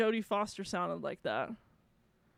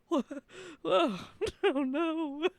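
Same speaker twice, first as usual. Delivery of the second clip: higher, slow imitation of a dismayed viewer; mock dismay; 6 semitones higher, 3 dB louder, slower than usual. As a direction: imitate a horrified reaction through laughter. Mimics Bryant's upset reaction, laughing.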